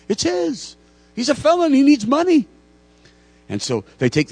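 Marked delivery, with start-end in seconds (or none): 0.1-2.5 put-on high, loud, insistent old priest; high emphatic priest. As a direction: defend him to the police. Priest defends the thief.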